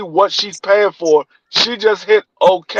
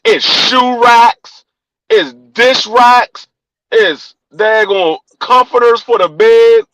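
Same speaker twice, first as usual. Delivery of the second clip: slow listing; 3 semitones higher, slower than usual. Real, not acted. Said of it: "listing the packages".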